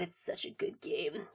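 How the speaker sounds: unvoiced echo to self; quiet muttered repeat; whispered aside. Real, not acted. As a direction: whisper it under your breath. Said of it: "whispered echo".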